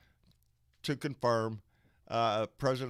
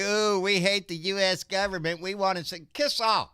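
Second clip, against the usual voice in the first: mock whiny voice, a bit higher and louder; mocking whiny secessionists; 7 semitones higher, 5 dB louder than usual. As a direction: sneer it like a whining crybaby. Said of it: "a bunch of whiny little bitches get together and say".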